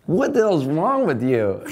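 loud, high, slow disbelief; incredulous mock scolding. Ballplayers confronting the musician who got banned, loud incredulous mock scolding. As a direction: demand it loudly, drawing it out in mock outrage.

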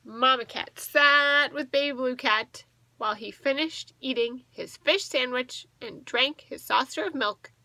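ordinary delivery, nothing marked

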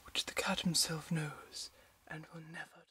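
soft, breathless, unvoiced whisper, hushed and remote; imitating Victoria's aloof film look in a hush. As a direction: whisper it like an untouchable film heroine. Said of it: "she's all like".

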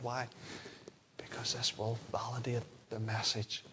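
low, very soft pulpit voice; hushed preaching. Real, not acted. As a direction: draw the listeners in quietly and gravely. Preacher drops to hushed delivery.

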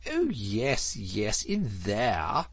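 mildly exasperated concession in a put-on voice; impatient. A narrator's reading. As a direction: brush the objection off impatiently. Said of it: "said the Toad impatiently".

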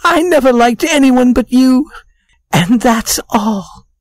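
high-pitched, weepy woman's confession; tearful. A narrator's reading. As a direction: confess through acted tears, voice pushed way up. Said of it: "began to cry".

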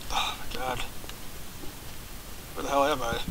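breathless hushed oh my god; startled whisper. Real, not acted. Player spotting something in a dark cave, whispered. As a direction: whisper it as if something might hear.

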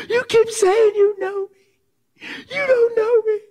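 very high, loud sobbing protest; crying outburst. Retold or imitated. Woman denying he knows her, loud tearful high wail. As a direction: cry it out, pushing back through tears. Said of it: "So she's freaking out".

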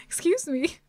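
ordinary delivery

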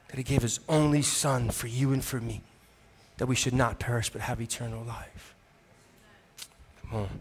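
hushed, intimate promise of eternal life; soft tender preaching. Acted. Soft closing part of sermon.

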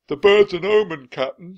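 high, loud pirate warning; alarmed shout. A narrator's reading. Stinky warns the captain about the bird, shouting. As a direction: shout it as a bad omen. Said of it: "shouted Stinky".

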